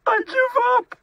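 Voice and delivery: loud, very high wail; blubbering. A narrator's reading. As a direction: give in with a tearful, high-pitched cry. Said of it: "he blubbered".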